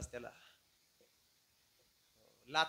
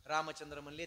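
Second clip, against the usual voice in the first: ordinary talk, nothing marked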